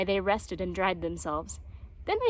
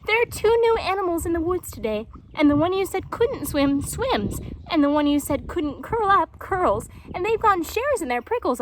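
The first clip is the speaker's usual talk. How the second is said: put-on excited young jaguar; 6 semitones higher, 4 dB louder than usual. Young jaguar tells mother.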